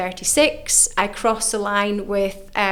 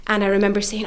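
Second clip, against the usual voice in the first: usual speaking voice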